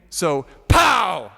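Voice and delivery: a sudden shouted gunshot pow; loud comic pow. A narrator's reading. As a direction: bark it out like a shotgun blast. Narrator voices the gunshot.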